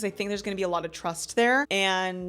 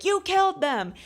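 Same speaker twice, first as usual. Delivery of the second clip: high, loud mock-furious accusation; acted accusation; 11 semitones higher, 5 dB louder than usual. Angry heroine accusing Luther in a retelling, mock fury. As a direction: throw the charge at him, pitch leaping up.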